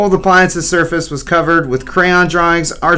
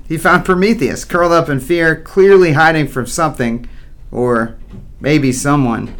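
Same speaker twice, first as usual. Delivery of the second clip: usual speaking voice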